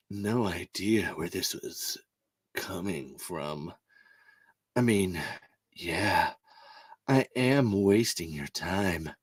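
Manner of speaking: low, slow, mock sultry reading; hammed-up seductive read, sinking to a whisper; cringy copulations voice. Reading fan erotica in a mock sultry voice. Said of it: "I'm gonna read this in my best, uh, cringy copulations voice".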